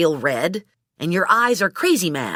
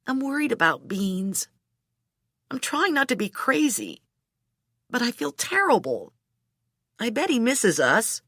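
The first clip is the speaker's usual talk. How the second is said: higher, softened, worried voice; tearful; 6 semitones higher, 4 dB quieter than usual. A narrator's reading. Heroine confesses to Hooker she feels terrible, mildly tearful. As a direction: hold back tears while confessing worry. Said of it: "A tear slid down my cheek".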